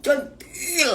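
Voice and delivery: high, slow coy teasing; flirty coquette. Acted. Mother mocking her own flirtiness in a high voice. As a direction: coo it coyly up high. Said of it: "centilnya Bunda".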